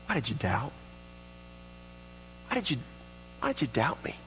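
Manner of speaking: soft, hurt but tender question; kind, fatherly. Acted. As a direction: ask gently, as a loving father, with long pauses. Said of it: "how he deals kindly with us".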